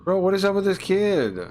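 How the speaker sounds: high, loud disbelieving outburst; freaked out. Real, not acted. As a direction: shout it in shocked disbelief. Creeped out by the game child.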